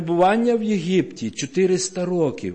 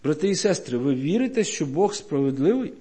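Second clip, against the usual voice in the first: ordinary delivery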